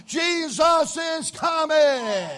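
slow, drawn-out shout of faith; preaching shout. Acted. Preacher proclaiming the Lord's return, slow loud shout. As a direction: stretch it out and proclaim it.

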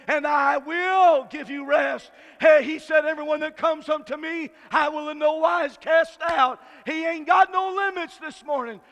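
loud, slow shouted scripture; preaching at full force. Acted. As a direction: proclaim it to the whole church.

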